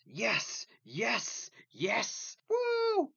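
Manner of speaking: high-pitched repeated cheers ending in a whoop; ridiculously hyped. Acted. Teen cheering in his head at mom's news, hyped. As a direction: celebrate wildly, each burst pitched high. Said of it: "Getting all hyped and ridiculous about it".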